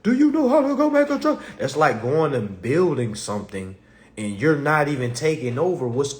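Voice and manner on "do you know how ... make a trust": higher, nagging mock question; lightly voiced questioning folks; mock asker. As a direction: imitate folks asking, pitched up. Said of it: "Folks be asking me".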